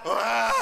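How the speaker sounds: imitated bawl breaking into sobs; mock sobbing. Priest imitating children remembering their mothers, mock sobbing. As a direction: burst into exaggerated, stuttering boo-hoos.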